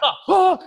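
two high, loud karate cries; imitated punch shouts in quick succession; hammed-up martial-arts "hah, hah!"; mock fight yells. Imitating punches in film scene.

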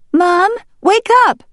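put-on high, loud young duck; urgent wake-up call. A narrator's reading. Green Top shaking his mother awake, urgent high call.

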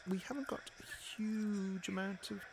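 whispered. Acted. Urgent aside before curtain.

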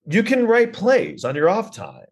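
high, slow comic exasperation; exasperated retort. Acted. Comic answering the would-be playwright, slow high exasperation. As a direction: spell it out slowly, pitched high.

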